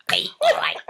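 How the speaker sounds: quick mock Mancunian "hey, all right"; breezy Manchester greeting, put on; Mancunian accent. [name] imitating Hazel in a Mancunian accent. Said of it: "I just sort of imagine her like that".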